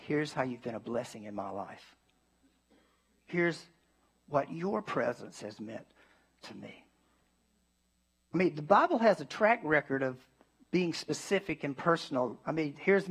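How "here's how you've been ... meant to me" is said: sincere thanks. Retold or imitated. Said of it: "and saying".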